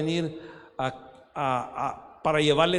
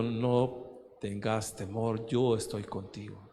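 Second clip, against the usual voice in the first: gentle divine reassurance; 6 semitones lower, 5 dB quieter, slower than usual. Retold or imitated. Quoting the Lord's words.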